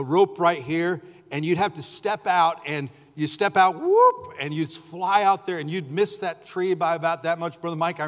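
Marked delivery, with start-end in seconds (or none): 3.8-4.3 sharp high whoop; swoosh whoop. Retold or imitated. Dad telling of the rope swing, a high sharp whoop.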